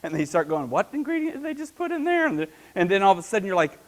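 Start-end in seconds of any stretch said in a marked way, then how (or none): 0.7-2.3 much higher, baffled squeak; confused disbelief. Retold or imitated. Viewer losing track of the recipe video, baffled and high. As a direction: sound lost and incredulous.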